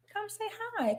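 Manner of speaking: high, quiet sing-song coaxing; coaxing a pet. Real, not acted. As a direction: gently coax the dog over. Coaxes dog to greet viewers.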